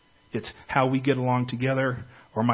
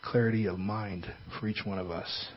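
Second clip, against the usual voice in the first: soft, earnest prayer voice; 4 dB quieter than usual. Acted. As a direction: pray quietly and earnestly. Leads a prayer.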